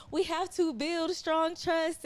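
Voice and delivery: mock the preachy by-the-book parent, slowly; by-the-book lecturer. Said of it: "extra by the book".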